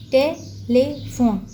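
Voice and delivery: टेलीफोन slowly split टे, ली, फोन; three separate beats in टेलीफोन; syllable by syllable. Shown as a demonstration. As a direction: pause between each piece of टेलीफोन. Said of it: "टुकड़ों में क्यों करते हैं".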